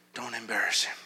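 hushed, whispered inner warning. Retold or imitated. God's prompting in the preacher's head, whispered. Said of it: "Something whispered in my head".

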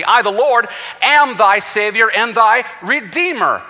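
high, loud, slow scripture proclamation; full-force preaching. Acted. Preacher quoting Isaiah to the congregation, full-force preaching. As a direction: ring it out as God's own declaration.

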